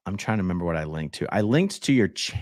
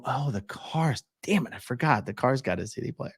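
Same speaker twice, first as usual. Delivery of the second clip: realization; 5 semitones higher, 5 dB quieter than usual. Retold or imitated. Realizing own mistake.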